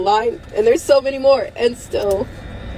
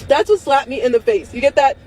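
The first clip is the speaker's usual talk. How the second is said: usual speaking voice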